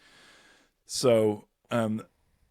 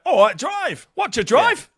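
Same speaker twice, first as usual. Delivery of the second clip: much higher, louder Cockney "All right, Drive"; mock Cockney greeting; 13 semitones higher, 7 dB louder than usual. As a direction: ham up a Cockney cab greeting. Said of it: "it feels Cockney banterish".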